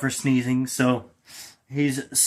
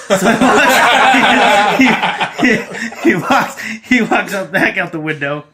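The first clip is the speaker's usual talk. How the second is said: real giggles breaking up the words; laughing; 8 semitones higher, 8 dB louder, slower than usual. Real, not acted. Storyteller cracking up at his own joke, laughing through the words. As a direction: laugh through it, pitch jumping much higher and louder.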